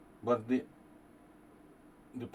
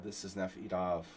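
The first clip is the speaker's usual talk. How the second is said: normal talking voice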